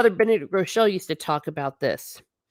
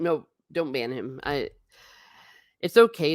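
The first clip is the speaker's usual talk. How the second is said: ordinary talk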